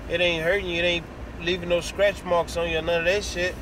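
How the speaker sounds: ordinary talk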